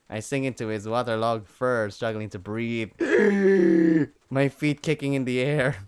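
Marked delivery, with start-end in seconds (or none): 3.0-4.1 high sharp loud gasp; startled breathless gasp. Acted. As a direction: gasp for air. Struggling to breathe in fur.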